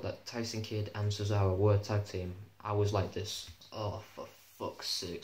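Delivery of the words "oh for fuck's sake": exasperated. Retold or imitated. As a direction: mutter it slowly in retold dismay. Retold dismay at a tag team.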